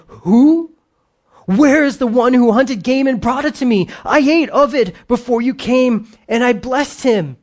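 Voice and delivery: put-on high, loud, alarmed old father; an old man who realizes he's been found out; panicked cry of a deceived father; trembling panic. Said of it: "So Isaac trembled exceedingly".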